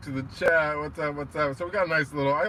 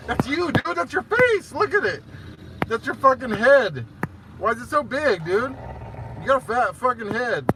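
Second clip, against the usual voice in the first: high, loud, gushing pet voice; loud, squeaky affection for a dog; teasing puppy talk; 10 semitones higher, 6 dB louder than usual. Real, not acted.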